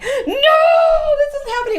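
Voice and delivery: high, loud hammed-up wail of denial; mock wailing. A wife mocks the hysterical crying she avoided, wailing shout. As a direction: throw out a fake sobbing shout, half laughing. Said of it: "it was more like".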